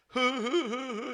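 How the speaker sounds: high panicked hoo hoo; high, frantic hooting; freaked-out hoots. Retold or imitated. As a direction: yelp in shock like your hand just got shot. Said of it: "Cody freaks out".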